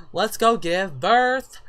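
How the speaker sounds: loud, high, stretched-out words; excited cheer. Real, not acted. Player cheering the Sim off to give birth, excited. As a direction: draw it out in celebration.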